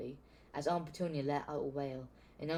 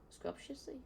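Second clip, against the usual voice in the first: puzzled at own word; 11 semitones higher, 9 dB quieter than usual. Real, not acted.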